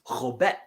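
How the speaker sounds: Robet with a throaty, scraped first consonant; Klingon pronunciation. Shown as a demonstration. Teacher modelling Robet for a student, guttural Klingon pronunciation. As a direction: say Robet with a guttural opening sound. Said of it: "And then for no, it's".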